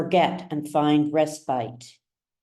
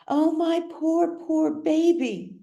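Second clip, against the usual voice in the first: much higher, slow, put-on pity; Alma cooing over River; 12 semitones higher than usual. Mother to her shivering son, high and slow in put-on pity. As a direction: coo over him like a fretting mother. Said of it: "she cries".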